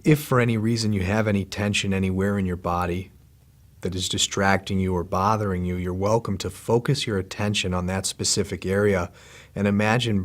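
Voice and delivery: soft, unhurried meditation voice with long pauses; calm, hushed body-scan guidance; guided meditation. Real, not acted. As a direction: guide them gently into relaxing, leaving space after each phrase.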